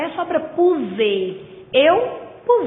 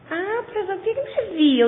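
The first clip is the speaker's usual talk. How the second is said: higher-pitched student interrupting with a doubt; raised voice of a questioning student; student's question; 8 semitones higher, faster than usual. Acted. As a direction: put on a pupil's puzzled query.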